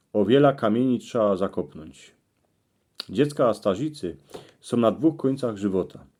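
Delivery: plain reading voice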